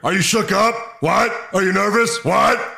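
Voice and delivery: barking drill instructor, high and loud; drill sergeant. Acted. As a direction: bark it like a furious sergeant. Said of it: "I'll be Stone Cold as Hartman".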